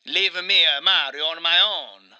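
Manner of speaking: wronged Italian husband, hammed up; Mario with Italian accent. Mario confides his brother's betrayal, in a hammed-up Italian accent. Plays Mario being interviewed.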